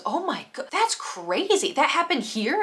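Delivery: high, drawn-out amazed reaction; mock astonished. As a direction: imitate an eager listener hearing hot gossip. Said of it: "immediately locked into the conversation".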